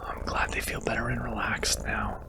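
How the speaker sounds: hushed whisper, barely voiced; a tender whispered aside; soft whisper aside. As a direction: whisper so you don't disturb them. Aside while hugging the purring listener.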